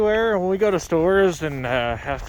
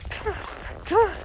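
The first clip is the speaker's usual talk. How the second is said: high coaxing call; coaxing the dog; 12 semitones higher than usual. Real, not acted. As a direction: sweet-talk the dog to come. Calling the dog sweetly.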